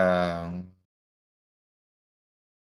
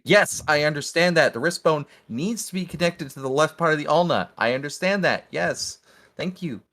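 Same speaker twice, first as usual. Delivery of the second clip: irritated high raised voice; exasperated, louder; 8 semitones higher, 4 dB louder than usual. Acted. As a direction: snap back at a nagging pest, pitched up. Ardwell snapping at nagging bat.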